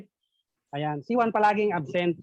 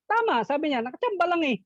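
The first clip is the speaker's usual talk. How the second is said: much higher dismissive teacher; mock teacher; 10 semitones higher, faster than usual. Teacher dismissing Juan's answer, much higher. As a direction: brush off the lucky answer.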